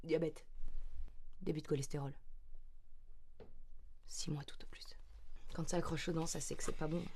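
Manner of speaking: whisper. Acted. Doctor whispering a grim diagnosis to herself, hushed. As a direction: whisper the bad news under your breath.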